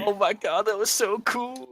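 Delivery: high, slow mock gushing; awestruck teen. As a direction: imitate a kid amazed by the first smoke. Imitates awed teens after smoking.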